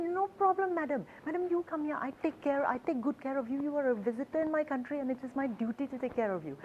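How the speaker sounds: the driver promising care, in a put-on Hindi accent; hammed-up Hindi accent, fast at the end. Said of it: "my attempt at a Hindi accent".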